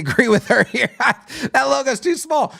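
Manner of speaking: high voice breaking into laughter; laughing agreement. Real, not acted. Web reviewer siding with Bev on the small logo, laughing. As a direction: laugh through the words as you agree.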